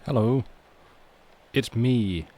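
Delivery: ordinary delivery, nothing marked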